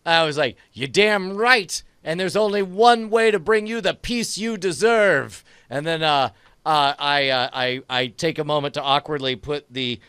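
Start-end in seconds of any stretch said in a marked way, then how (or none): 0.7-5.4 raised, emphatic action-hero speech; hammed-up hero retort. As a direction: declare it like a movie hero passing sentence. Hero retorts to villain before attack.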